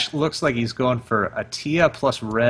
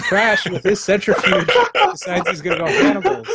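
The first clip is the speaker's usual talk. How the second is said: laughing; 8 semitones higher, 3 dB louder than usual. Real, not acted. Caster laughs at player's move.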